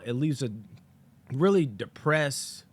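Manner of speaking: ordinary talk, nothing marked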